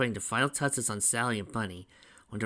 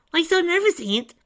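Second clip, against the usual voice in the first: put-on high, loud teasing kid voice; joking kid sidekick; 10 dB louder than usual. A narrator's reading. Tails nudges the nervous groom, joking. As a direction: tease him in a pitched-up little-brother voice. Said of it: "Tails joked".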